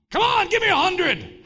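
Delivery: high, loud barked order; drill sergeant. Retold or imitated. Drill sergeant ordering recruits to do pushups, barking.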